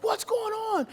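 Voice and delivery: high, anxious question; alarmed question. Retold or imitated. Father bursting in on the mother, high alarmed question. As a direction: burst in demanding answers. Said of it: "I'm like".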